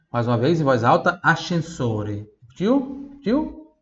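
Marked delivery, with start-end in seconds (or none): 2.4-3.7 playful check. Acted. Teacher checks students repeated.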